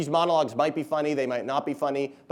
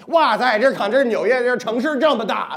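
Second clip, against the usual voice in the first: put-on loud, much higher voice in a thick Beijing accent; old Beijing cab driver; 10 semitones higher, 9 dB louder than usual. Pranking Chinese tourists in New York, loud Beijing accent. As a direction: sneak up and boom like an old Beijing cabbie. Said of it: "seventy-year-old Beijing cab driver".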